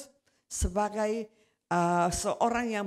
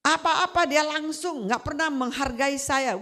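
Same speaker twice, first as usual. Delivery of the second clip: imitating a friend venting furiously about his leader; retold angry rant; 6 semitones higher than usual. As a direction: friend fuming about his pastor, rising to a shout. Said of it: "Wah, dia marah-marah".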